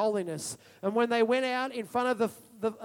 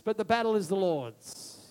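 usual speaking voice

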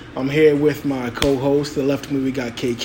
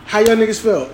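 laughing mock voice; 7 semitones higher, 4 dB louder than usual. Joking put-on voice.

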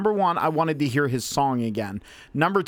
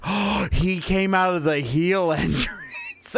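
gasping, drawn-out retold discovery breaking into laughter; slow, incredulous re-enactment of spotting it; stunned realization; 3 semitones higher, slower than usual. Telling co-hosts what he saw on YouTube, stunned realization. Retelling shocked realization at video.

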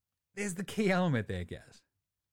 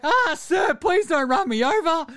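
mock cowering plea; 12 semitones higher, 6 dB louder than usual. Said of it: "You're like".

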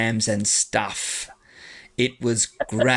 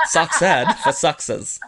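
put-on laughing New Zealand accent, slower; 4 semitones higher than usual. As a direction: another bad Kiwi try through laughter. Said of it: "That was terrible".

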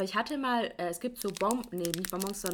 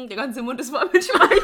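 laughing; 7 semitones higher, 13 dB louder than usual. Real, not acted. Laughs at the sour candy.

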